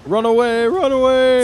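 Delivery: high, loud urging to flee; alarmed calling out. Real, not acted. Co-host urging the player away from the monster, high alarmed call. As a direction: call out a warning, pitched high.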